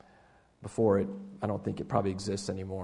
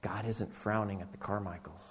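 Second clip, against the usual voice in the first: usual speaking voice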